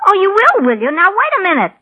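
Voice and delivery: sharp, high, loud protest; indignant outburst. Acted. Showgirl snapping back at the cocky boss, indignant. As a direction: bristle at his cheek, voice jumping up.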